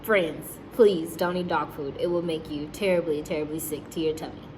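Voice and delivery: usual speaking voice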